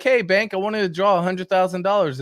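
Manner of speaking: mock customer. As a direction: lightly play a cocky customer at the bank. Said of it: "Be like".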